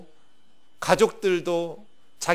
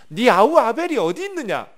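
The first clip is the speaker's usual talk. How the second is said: 아벨이 pushed up and out loud, 네 아우 아벨이 어디 있느냐 as a demand; pressing divine question; 5 semitones higher, 6 dB louder than usual. Acted. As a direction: ask it louder, like God pressing for a confession. God's question repeated, stressed.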